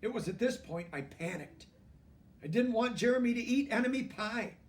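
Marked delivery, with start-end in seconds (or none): none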